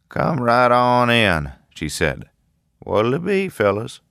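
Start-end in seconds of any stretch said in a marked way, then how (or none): 0.1-1.5 slow, higher, louder Southern drawl from the woman at the counter; heavy southern accent. A narrator's reading. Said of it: "a heavy southern accent". 2.8-4.0 much higher Southern diner voice; heavy southern accent. A narrator's reading. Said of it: "a heavy southern accent".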